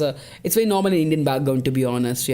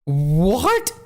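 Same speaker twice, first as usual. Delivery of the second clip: incredulous; 4 dB louder than usual. Real, not acted. Still shocked by the rule.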